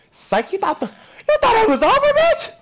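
climbing, high mocking taunt; escaped player taunting the others, rising high; gloating taunt. Real, not acted. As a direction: rub it in, voice soaring.

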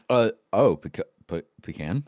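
low, suspicious clerk; post office worker. Acted.

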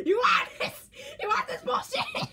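high, shouted, hammed-up menace breaking into laughter; mock bat threat. Acting out Riley swinging the bat, high mock-threatening shout. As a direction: yell a playful threat, very high and loud. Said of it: "She be swinging that bat like".

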